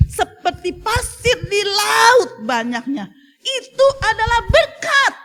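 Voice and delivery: sermon peak, half laughing, half weeping; impassioned preaching. Acted. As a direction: proclaim the blessing with a catch of emotion. Preaching reading of scripture.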